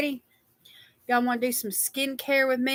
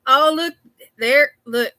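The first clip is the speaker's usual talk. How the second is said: louder, higher burst of delight; excited; 4 semitones higher, 8 dB louder than usual. Real, not acted. Host excitedly pointing viewers to a makeup comment. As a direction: point it out with a bright rush.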